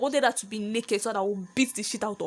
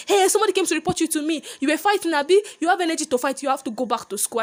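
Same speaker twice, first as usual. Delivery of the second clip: high, fast and loud telling-off; a mum scolding her child for fighting; mother telling her daughter to go back to school, scolding; 6 semitones higher, 3 dB louder, faster than usual. Retold or imitated.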